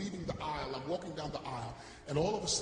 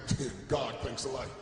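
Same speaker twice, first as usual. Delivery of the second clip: low, deflated sarcastic thanks; dry sarcasm; 6 semitones lower than usual. Retold or imitated. Embarrassed man thanking God for being recognized, low dry sarcasm. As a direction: drop it low, wryly.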